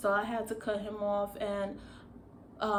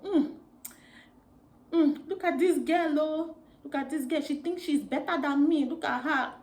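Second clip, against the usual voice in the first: put-on high, loud African accent; mocking coworkers in an African accent; 6 semitones higher, 5 dB louder than usual. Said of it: "that's in my African accent".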